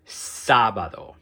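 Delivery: "sábado" slow, first á drawn out and emphasized; stress on first A. Shown as a demonstration. Teacher repeats the example word for students, stressed first syllable in "sábado". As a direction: punch the opening syllable of "sábado". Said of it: "put extra stress on that first A".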